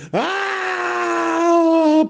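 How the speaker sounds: high piercing shriek of "ah"; mother's scream. Acted. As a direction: let out a desperate mother's cry. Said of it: "pegando gritos". (grito agudo y desesperado de una madre)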